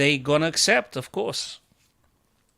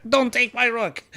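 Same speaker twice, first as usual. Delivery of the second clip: pleading yelp; 9 semitones higher, 4 dB louder than usual. Real, not acted. Pleading with opponent over rook.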